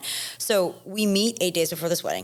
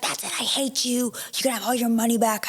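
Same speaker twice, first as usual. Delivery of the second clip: mock furious outburst; 6 semitones higher than usual. Planner's outburst retold.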